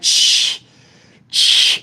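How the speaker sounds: hissing "shh" of a stop; short airy hiss; imitated noise. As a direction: imitate a vehicle hissing to a halt. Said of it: "it stopped like".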